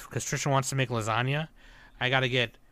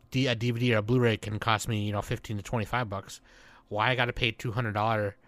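ordinary talk

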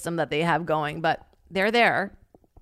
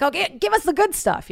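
impatient tourist; 11 semitones higher, 4 dB louder than usual. Retold or imitated. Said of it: "We're like".

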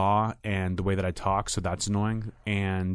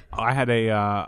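ordinary talk, nothing marked

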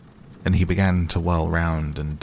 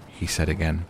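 ordinary delivery, nothing marked

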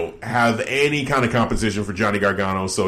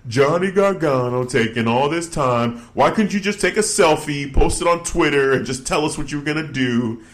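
mocking whiny heel; 5 semitones higher than usual. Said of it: "He's like".

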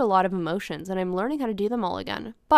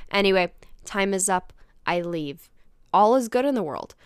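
ordinary talk, nothing marked

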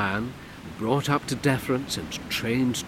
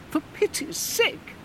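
high breathy plea; exasperated Comtesse. Acted. Comtesse pleading with Bernard, exasperated. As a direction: sigh it out as the lady. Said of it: "instructed the Comtesse".

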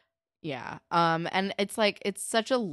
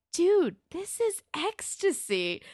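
high, drawn-out mock amazement; quoted TV line; 12 semitones higher, 4 dB louder, slower than usual. Quoting the show's line, high and drawn-out. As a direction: draw it out high and spacey. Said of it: "the line is".

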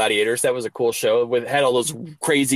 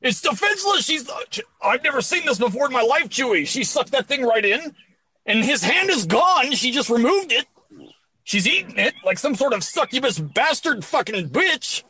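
high-pitched hyped wrestling play-by-play; mock commentator; 10 semitones higher than usual. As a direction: call it like a wrestling announcer gone wild.

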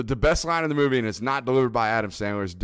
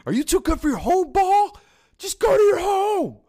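very high, loud mock tantrum; movie-quote yell; 6 dB louder than usual. Co-host echoing a favorite movie line, mock yelling. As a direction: holler it in comic exasperation, stretching the end.

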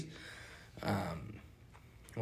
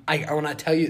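ordinary delivery

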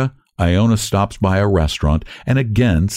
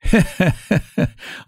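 high, acted chuckle; short, high put-on laugh; 10 semitones higher than usual. A narrator's reading. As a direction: laugh along as the humble cabman. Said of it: "laughs Iona".

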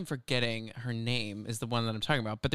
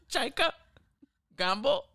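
mock Australian names, each a question; bad Australian accent; 11 semitones higher than usual. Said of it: "My Australian accent is terrible".

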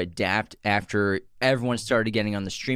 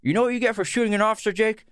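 high, fast Denzel, cigarette in mouth; 3 semitones higher than usual. Acted. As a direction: talk round an imaginary cigarette. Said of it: "I gotta pretend like there's a cigarette in my mouth".

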